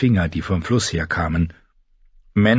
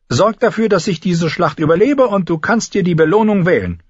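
high, insistent character voice; high voice, pressing the point; Tyrion, wry lordling; 10 semitones higher, 4 dB louder than usual. Acted. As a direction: play the little lord, pitched high and forceful. Tyrion's dialogue in narration.

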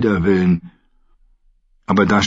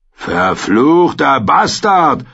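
slow, raised, venomous curse; cursing; 6 semitones higher, 4 dB louder, much slower than usual. A narrator's reading. One of the brothers curses the axe-wielding host, angry oath. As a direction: spit the insult, stretching it out. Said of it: "hörte Sam einen der Garth fluchen".

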